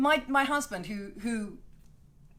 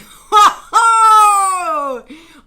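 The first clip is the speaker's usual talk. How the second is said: loud laughing howl; loud cackle; 7 semitones higher, 20 dB louder than usual. Real, not acted. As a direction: let a long loud laugh rip. Laughing at Bolton news.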